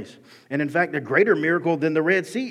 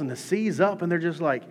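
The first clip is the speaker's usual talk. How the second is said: usual speaking voice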